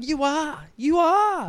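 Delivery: high, rising jeer, imitating the crowd; mock taunting. Imitating people who found out about it, high-pitched mock taunting. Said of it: "started going".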